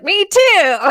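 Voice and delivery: loud, high mock-excited yelp; excited retelling. Woman quotes her own reply to co-host, excited yelp.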